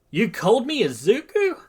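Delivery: high, loud disbelief; stunned question. A narrator's reading. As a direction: ask it in astonishment. Midoriya stunned by the name.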